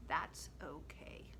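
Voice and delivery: soft, slow, whispered comfort; reassuring whisper. Real, not acted. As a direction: gently whisper it's okay. Reassuring children about mistakes.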